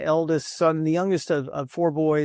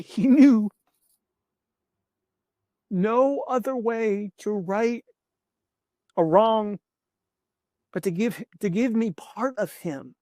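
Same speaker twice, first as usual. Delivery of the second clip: choked up; 5 semitones higher than usual. Real, not acted. Speaker moved, voice rising.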